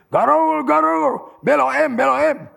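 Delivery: loud, high hawker's chant; Batak bus conductor. Retold or imitated. Imitating a Batak conductor calling passengers, shouting. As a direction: call out the route like a bus conductor. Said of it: "Orang Batak yang pernah jadi kenek ini".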